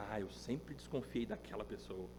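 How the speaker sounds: very soft, low imitation of suspicion; mock suspicious churchgoer. Preacher imitating someone investigating their pew neighbour, hushed suspicion. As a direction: mutter it as a pretend amateur sleuth.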